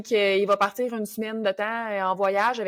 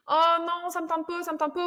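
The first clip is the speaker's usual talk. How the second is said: higher, lazy whine of the little voice in your head; whiny inner voice; 7 semitones higher than usual. Pretend. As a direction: whine like you really don't want to go. Reluctant inner voice acted out. (voix intérieure geignarde, plus aiguë)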